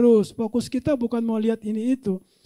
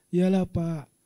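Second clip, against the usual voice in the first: a softer, meek please; softened plea; 5 semitones lower, 5 dB quieter than usual. Retold or imitated. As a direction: humbly ask with a soft voice. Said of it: "ngomongnya lah jadi lembut".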